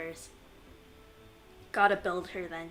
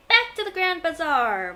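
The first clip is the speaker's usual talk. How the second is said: tag along announcement; 8 semitones higher, 10 dB louder than usual. Pretend. Playfully announcing next destination.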